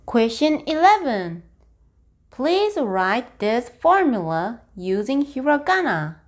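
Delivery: normal talking voice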